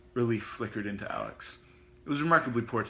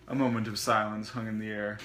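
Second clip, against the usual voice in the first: plain reading voice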